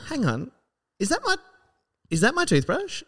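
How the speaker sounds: high, rising disbelief; indignant surprise. Retold or imitated. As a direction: catch her red-handed, incredulous. Catching her with his toothbrush.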